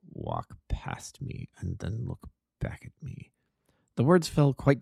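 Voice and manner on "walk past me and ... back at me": much lower and softer, a slow hushed whisper. Acted. Disguised Holmes secretly tells Watson to walk past, whispered low and slow. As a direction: breathe it low so nobody else hears. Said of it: "a low voice whispered".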